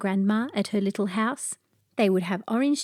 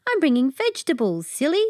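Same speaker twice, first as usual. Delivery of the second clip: teasing; 6 semitones higher, 4 dB louder than usual. A narrator's reading. Girl teases the dog.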